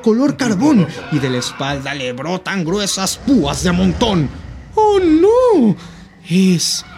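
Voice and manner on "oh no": high loud scared yelp; frightened cry. Acted. Mouse sees the gruffalo.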